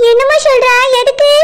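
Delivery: normal talking voice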